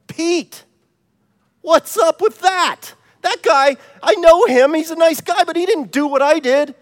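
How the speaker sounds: high-pitched, incredulous complaint; acted outrage, pitched well up; indignant protest. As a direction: protest the unfairness, voice pushed up high. Acted protest at heaven's gate.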